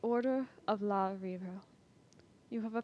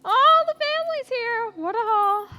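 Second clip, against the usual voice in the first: much higher, much louder gleeful crowing over the catch; gloating shout; 13 semitones higher, 10 dB louder than usual. Acted. Raider crowing at the captured family, loud gleeful shout. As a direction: crow over your prize like a greedy raider.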